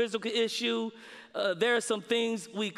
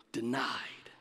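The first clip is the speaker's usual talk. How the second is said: whispered single word, much lower and softer; 11 semitones lower, 8 dB quieter than usual. Acted. Preacher revealing God's refusal to Paul, whispered. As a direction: drop to a whisper for the verdict.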